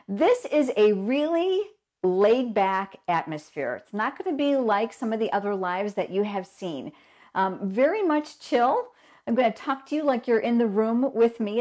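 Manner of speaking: ordinary delivery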